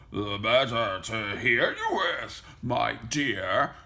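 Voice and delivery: croaky storybook wolf voice; croaking wolf. A narrator's reading. Wolf in bed answering Little Red, croaking voice. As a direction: croak it like a wolf posing as granny. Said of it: "croaked the wolf".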